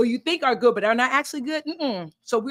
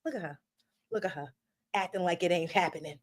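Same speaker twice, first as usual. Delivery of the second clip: much lower and softer, muttered side-eye; disdainful aside; 6 semitones lower, 10 dB quieter than usual. Acted. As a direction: judge her under your breath. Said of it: "she's looking at you like".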